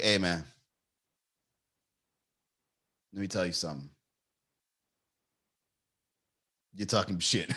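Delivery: showing how to win an argument, slow and low; talk really slow. Shown as a demonstration. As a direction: drag it out like a chin-rubbing sage. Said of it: "You gotta talk really slow".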